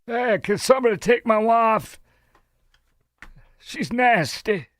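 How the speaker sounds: put-on high, slow, drawn-out old politician voice; a hammed-up Southern ex-president shout; Bill Clinton impression. Mimics Clinton over the clip.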